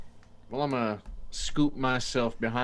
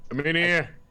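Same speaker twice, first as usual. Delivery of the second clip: calling out; 7 semitones higher, 6 dB louder than usual. Acted. Player voices character calling out.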